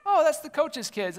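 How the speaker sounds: high, bright realization in an imitated voice; mock realizing spectator. Spectator explaining whose kids they are, high mock realization. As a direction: play someone catching on with a high "oh". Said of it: "somebody will say".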